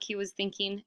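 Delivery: usual speaking voice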